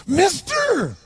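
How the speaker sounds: put-on high, strange voice of a drunk beggar; odd high cry of a drunk man; bizarre drunk voice. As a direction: squeak it out like a tipsy street guy. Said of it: "He had this really bizarre looking sounding voice".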